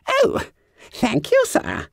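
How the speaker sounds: high squeaky startled stammer; flustered fat schoolboy. A narrator's reading. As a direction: squeal it, caught out. Bunter relieved.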